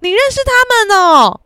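loud, high-pitched innocent question; loud and high, naive and oblivious. Retold or imitated. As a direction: call it across to the next table. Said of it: "他讲超大声说".

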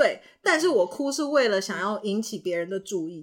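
ordinary talk, nothing marked

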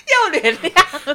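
high squealing laugh, slow teasing. Real, not acted. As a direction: laugh then tease him slowly. (边尖笑边调侃)

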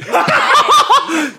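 high, loud burst of laughter; real shrieking laugh at the joke. Real, not acted. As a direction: let out a shrill laugh.

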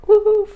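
high loud whimper; scared puppy whimper. Acted. Biscuit too scared to move, high whimper. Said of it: "结果Biscuit就".

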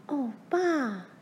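put-on high-pitched girl's exclamation; Mary crying out. A narrator's reading. Daughter interrupts father's story, crying out. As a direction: cry out like a dismayed daughter. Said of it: "玛丽叫着".

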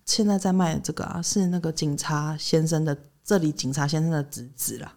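low, soft secretive murmur; hushed whisper-like talk. Retold or imitated. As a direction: lean in and tell a secret softly. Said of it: "她就很小声的说".

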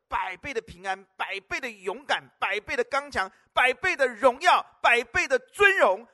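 each 百倍 hammered harder until the shout peaks; fiery preacher building the crowd up; building preaching. Acted. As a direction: list rises from firm to a loud, slowing cry. Preaching list building to climax.

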